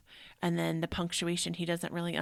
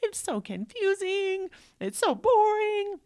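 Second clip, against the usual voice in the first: put-on whining child; 14 semitones higher, 6 dB louder than usual. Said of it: "It's like".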